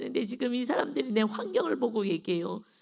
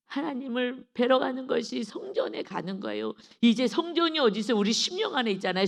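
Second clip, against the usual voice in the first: ordinary talk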